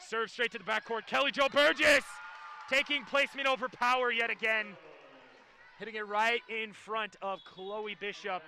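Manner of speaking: volleyball call building to a loud name; live play-by-play. Real, not acted. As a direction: call the rally, punching out the player.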